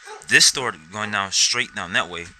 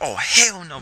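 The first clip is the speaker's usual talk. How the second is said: higher, louder retold exclamation; alarmed refusal; 7 semitones higher, 5 dB louder, slower than usual. To his cousin at the open door, alarmed refusal. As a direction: re-enact backing off in alarm.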